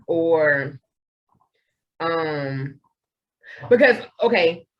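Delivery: normal talking voice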